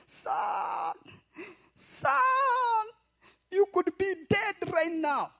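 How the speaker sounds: breaking voice with a cry; tearful mother. Acted. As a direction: plead through tears. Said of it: "the lady started crying".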